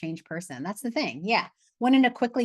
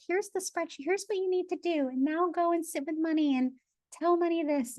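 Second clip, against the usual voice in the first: high, mock bossy instructor handing out tasks; hammed-up parody of a preachy money course; mocking bossy course teacher; 10 semitones higher than usual. Coach mocking other money courses to her group, high mock bossy voice. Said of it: "We're not gonna be like".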